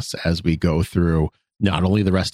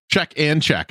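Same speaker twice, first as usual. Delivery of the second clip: ordinary talk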